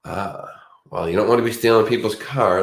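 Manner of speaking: ordinary talk, nothing marked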